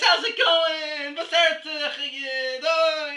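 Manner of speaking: high, loud, drawn-out greeting; over-friendly shouted greeting. Retold or imitated. Very friendly shul-goer greeting others, high loud shout. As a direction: holler it slowly across the street in mock friendliness. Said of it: "all screaming at each other".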